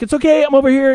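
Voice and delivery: quick high "it's okay" called across the room; calling out reassurance. Retold or imitated. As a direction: call over to a waking child. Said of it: "and I'm like".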